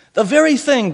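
high, loud and eager; delighted shout of discovery; excited cry. A narrator's reading. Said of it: "cried Billy".

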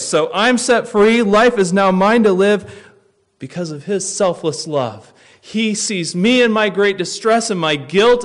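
loud, high impassioned preaching; soaring gospel proclamation; full force preaching. Acted. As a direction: preach it out, rising to a cry.

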